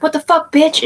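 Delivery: high, loud angry yell; retold yell. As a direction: yell it at her as she runs off. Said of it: "I was like".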